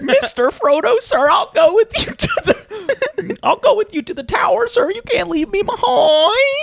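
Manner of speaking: very high, pleading British character; mock hobbit voice. Hobbit begging to join his master, high mock British plea. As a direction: beg in a squeaky hobbit voice.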